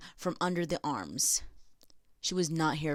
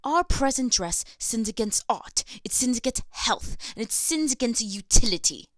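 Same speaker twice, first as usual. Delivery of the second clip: lightly put-on British accent, a little higher; 4 semitones higher than usual. Reading the pamphlet in a British accent. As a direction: read it like a proper British lady. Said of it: "I'm gonna say this in a British accent".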